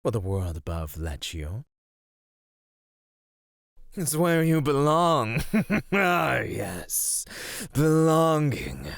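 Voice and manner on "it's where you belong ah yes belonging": loud, high-pitched scoff breaking into a laugh; loud derisive delight; mocking amusement. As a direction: throw it back at them, laughing. Mocks captive's idea of belonging.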